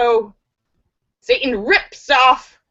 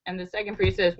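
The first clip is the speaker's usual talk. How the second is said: ordinary delivery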